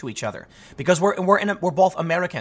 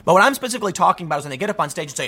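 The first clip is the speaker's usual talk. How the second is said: ordinary delivery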